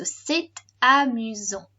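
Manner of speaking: slow "c'est amusant" with the T linking into amusant; T pronounced in liaison. Shown as a demonstration. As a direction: sound the T of c'est before amusant, drawn out. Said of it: "we do pronounce this T".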